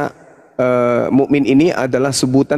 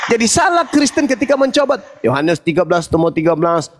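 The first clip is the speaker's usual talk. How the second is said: preaching; 13 semitones higher than usual. Acted. Heated religious debate preaching.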